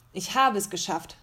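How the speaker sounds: ordinary delivery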